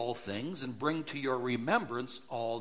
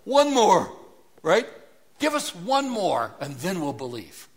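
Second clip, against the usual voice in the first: much higher, loud pleading demand; demanding Philip; 5 semitones higher, 10 dB louder than usual. Retold or imitated. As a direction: push for one more sign. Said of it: "what Philip has just said".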